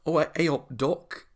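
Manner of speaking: a lightly put-on Northern English granny accent; hammed-up Northern English old lady saying hey up duck; Northern English accent, badly faked. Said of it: "was proper northern".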